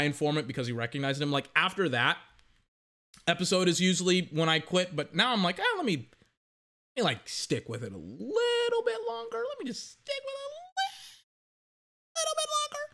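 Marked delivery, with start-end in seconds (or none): 5.6-12.8 very high, thin pleading voice, trailing softer; whiny self-talk. Retold or imitated. To himself, begging to keep watching the show: squeaky high whine. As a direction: coax yourself in a squeaky whine.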